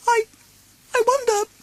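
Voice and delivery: very high, stammering little pig; nervous Piglet. Acted. Piglet frets about lost Roo, high and nervous. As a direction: falter it out like a scared piglet. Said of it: "Piglet said nervously".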